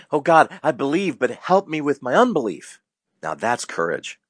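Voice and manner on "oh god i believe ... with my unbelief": much higher, pleading cry to God; a father's raised, imploring plea. Retold or imitated. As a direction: plead desperately for help. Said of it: "And he cried out".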